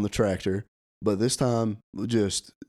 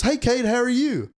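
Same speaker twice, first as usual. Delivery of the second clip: very high, louder imitated hello; mock friendly greeting; 6 dB louder than usual. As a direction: call out an absurdly high-pitched greeting. Gunman's sudden friendly greeting, retold.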